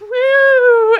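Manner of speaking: high, loud whoop; excited whoop. Retold or imitated. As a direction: whoop it out big. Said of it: "And I'm like".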